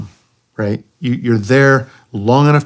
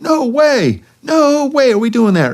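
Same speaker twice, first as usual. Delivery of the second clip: much higher, mock-refusing Puerto Rican accent; imitating a Puerto Rican buddy flatly refusing, pitched up high; high-pitched Puerto Rican accent, hammed-up refusal; thick Puerto Rican accent; 3 dB louder, slower than usual. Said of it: "in that thick accent".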